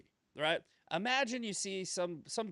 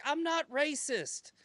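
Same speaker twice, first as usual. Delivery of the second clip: high, drawn-out denial; mock protest; 11 semitones higher, slower than usual. Accused people to their accusers, mock protesting denial. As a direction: plead innocence in a put-on voice.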